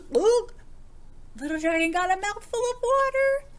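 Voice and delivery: loud, high, fast and gleeful; excited storytelling. A narrator's reading. The reader telling children the splash scene, loud and gleeful. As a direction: race through it with big delight.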